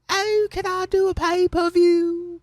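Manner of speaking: very high, drawn-out mock schoolgirl squeal; Leon impression. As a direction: stretch it out in a slow, high falsetto. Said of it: "that was my Leon voice".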